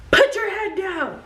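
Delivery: barked drill sergeant order. Retold or imitated. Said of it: "all you hear is".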